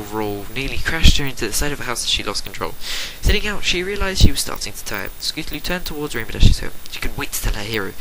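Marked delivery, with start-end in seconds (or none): none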